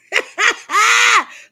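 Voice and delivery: loud, high put-on wail; hammed-up bawling; mock crying. Shown as a demonstration. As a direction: wail like you're proving you can cry. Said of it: "I'm in here crying for y'all".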